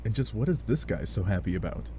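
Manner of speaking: usual speaking voice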